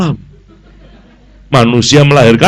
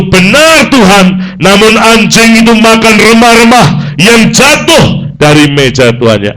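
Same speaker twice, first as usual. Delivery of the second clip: high, loud and slow, earnest plea; pleading reply; 9 semitones higher, 6 dB louder than usual. Acted. The woman answering Jesus in the reading, loud and pleading. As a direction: beg humbly but urgently. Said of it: "kata perempuan itu".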